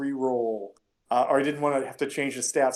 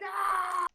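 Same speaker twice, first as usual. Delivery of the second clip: high shouted "Ah!" from the bar crowd; crowd whoop; 3 dB quieter than usual. Acted. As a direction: yell it like a cheering mob. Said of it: "everybody else in the bar is like".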